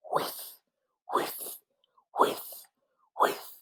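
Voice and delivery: breathy, very soft whiff, whiff; sprinkler whiff. Shown as a demonstration. Reading aloud, making the sprinkler's breathy whiff sound. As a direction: let the air hiss out like a turning sprinkler. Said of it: "They go like this".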